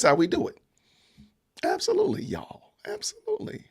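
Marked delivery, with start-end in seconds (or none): 1.6-2.2 high squeaky agreement; mock high-pitched yes; falsetto absolutely. Real, not acted. Playful agreement about the South. 2.2-3.6 breathy whispered absolutely. Real, not acted. Playful aside after high absolutely.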